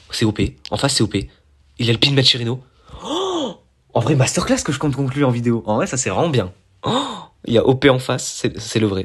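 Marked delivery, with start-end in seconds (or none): 3.0-6.5 much louder, high, excited outburst; thrilled high-pitched cry; excited shout. Real, not acted. Spots a famous player opponent. 6.8-9.0 louder and high with excitement; excited louder exclamation. Real, not acted. Confirming the famous opponent.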